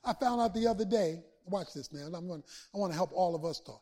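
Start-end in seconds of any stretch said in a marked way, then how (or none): none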